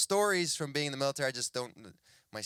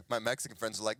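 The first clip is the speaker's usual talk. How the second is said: ordinary delivery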